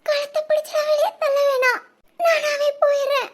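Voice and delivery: tearful goodbye; crying. Acted. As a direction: sob it out, broken. Crying as she's pushed out.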